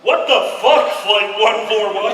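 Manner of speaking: loud exasperated controller; loud incredulous tower operator; angry tower voice. Retold or imitated. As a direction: scold the pilot over the radio. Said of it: "this is the tower".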